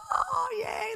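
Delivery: very high-pitched mock cheer; happy cheering. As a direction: imitate a delighted little crowd. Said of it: "they're all happy".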